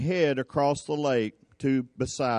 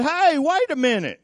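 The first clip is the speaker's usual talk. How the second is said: high-pitched, acted double take; startled call; 11 semitones higher, 4 dB louder than usual. Disciples spotting Jesus going by their boat, startled. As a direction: call after him in mock surprise.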